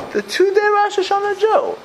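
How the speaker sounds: high, sing-song mocking of the nickname. Rabbi mocking a congregant's nickname, sing-song and high. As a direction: toss out the label like a playground taunt.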